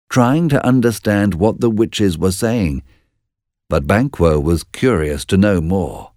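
usual speaking voice

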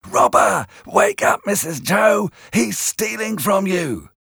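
imagined voices crying thief; accusing voices. A narrator's reading. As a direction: voices in Pip's head accusing him. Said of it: "voices in my head shouting".

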